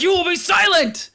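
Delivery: high-pitched commanding shout, imitated; mock-imperious yell; Bortus yell. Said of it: "did he yell at".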